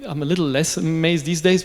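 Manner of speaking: normal talking voice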